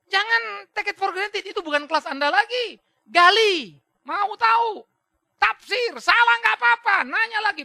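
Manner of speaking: loud, high, impassioned sermon exhortation; full-force preaching. Acted. Preacher urging the congregation to dig into scripture, at full force.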